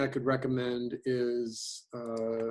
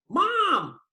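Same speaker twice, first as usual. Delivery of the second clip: much higher, louder cry of Mom; accented call; 8 dB louder than usual. Shown as a demonstration. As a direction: call out Mom with a big high jump. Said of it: "you start out with an accent".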